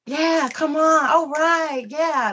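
装酷的妈妈，拖长音调高; 像追潮流的妈妈一样慢悠悠起哄; trying to be hip. Retold or imitated. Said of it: "try to be hip".

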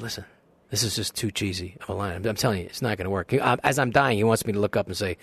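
usual speaking voice

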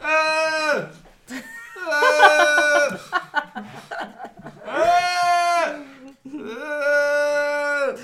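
high, loud goat bleats. Pretend. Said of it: "I got a good goat".

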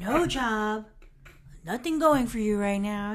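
loud mock-encouraging jab; lightly acted sneaky sarcasm; sarcastic needling. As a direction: lay on the backhanded praise loudly. Chandler needling Monica.

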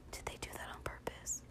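hushed, awed whisper; stunned whisper. Real, not acted. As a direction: whisper like your mind was just blown. Wondering if Disney did it deliberately.

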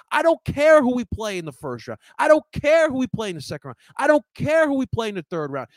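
loud, high hype speech; fired-up rant. Real, not acted. Rallying viewers for the playoffs, loud and fired up. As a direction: pump the fans up, hammering it home.

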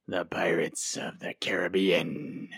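"the Pirates of the Caribbean" drawn out slowly in a hammed-up movie pirate accent, rising at the end; slow, rolling pirate-accent "Caribbean" with a lift. Host announcing the topic in a hammed-up pirate accent. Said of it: "my not so great impression of ... pirate speak".

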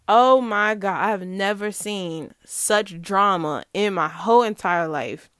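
ordinary talk

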